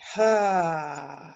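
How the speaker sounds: voiced "haa" sigh, trailing away; audible sigh. Shown as a demonstration. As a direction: exhale audibly through an open mouth. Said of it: "An audible sigh".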